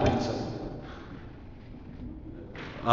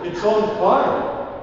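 higher, slower mock panic; mock alarm; 7 semitones higher, 5 dB louder, slower than usual. Flesh inventing an emergency, hammed-up panic. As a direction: ham up the alarm.

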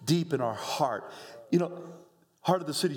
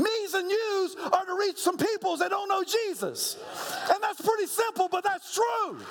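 full-force preaching. Real, not acted. Pastor preaching hard to his church, full force. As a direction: preach it to the rafters.

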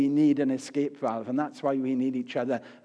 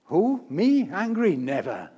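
feigned innocence; 3 semitones higher than usual. Acted. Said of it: "We pretend to feel no anger at all".

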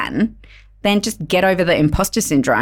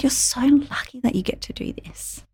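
soft retold whisper of a guest's words; whispered quote; 7 semitones higher, 6 dB quieter than usual. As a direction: hush it like a secret passed on the way out.

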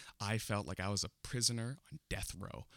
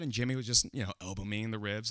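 ordinary talk

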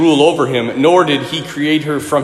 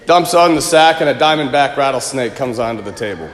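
normal talking voice

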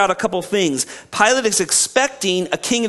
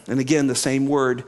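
usual speaking voice

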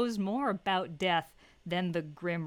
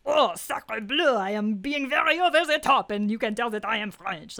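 put-on John Cleese French accent; 3 semitones higher, 8 dB louder than usual. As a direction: exaggerate a comic French accent at full volume. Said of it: "John Cleese's French accent".

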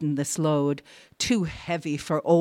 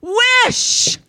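very high, loud, drawn-out command; ordering shout; 9 dB louder than usual. A narrator's reading. Wife orders her wavering husband, shrieking. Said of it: "she ordered".

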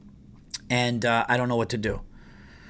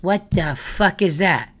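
higher, slow, mocking disbelief; mimicking Bobby; 5 semitones higher, slower than usual. Friend mocking his son's sleeping bag, slow and high. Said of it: "Bobby goes".

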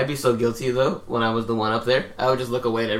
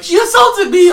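much higher, louder whine; whiny manager; 10 dB louder, slower than usual. Retold or imitated. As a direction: play the victim. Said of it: "He's like".

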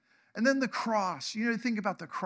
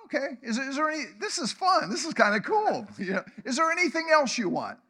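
higher, louder, laughing, fast at the end; playful delighted God; 6 semitones higher, 4 dB louder than usual. Pretend. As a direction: sound like a giver having fun. God voiced enjoying giving.

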